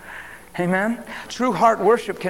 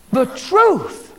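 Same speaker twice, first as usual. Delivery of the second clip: higher, louder, ringing out; emphatic cry; 7 semitones higher, 7 dB louder than usual. Real, not acted. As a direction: proclaim it with full force. Emphatic climax of repeated phrase.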